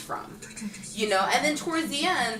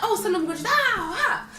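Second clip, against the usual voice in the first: cheesy kung fu fighter; 10 semitones higher than usual. Pretend. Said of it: "It wasn't like".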